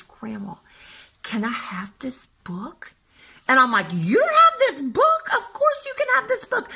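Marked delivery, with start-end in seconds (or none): 0.0-2.9 put-on whispered child request. Grandchild asks for the book. 3.9-6.7 delighted grandma. Retold or imitated.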